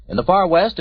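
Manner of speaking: ordinary delivery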